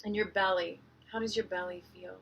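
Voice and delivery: soft, unhurried meditation voice with long pauses; hushed, calming breathwork instruction; guided meditation. Real, not acted. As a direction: gently guide listeners into their breath.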